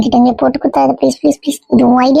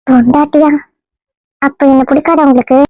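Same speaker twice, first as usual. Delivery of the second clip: a lightly put-on girl's voice, hurt and coy; woman's voice; 3 semitones lower than usual. Disguised man plays the girl wooed by the husband. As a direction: play the wronged young woman, only lightly feminine. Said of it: "இன்னும் பொம்பளை குரல்ல தான் பேசுற".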